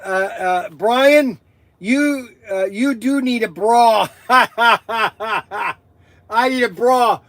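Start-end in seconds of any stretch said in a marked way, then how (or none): 4.3-5.7 short staccato burst of laughter; real cackling laugh. Real, not acted. Streamer told he needs a bra, cackling. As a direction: crack up in a rapid cackle.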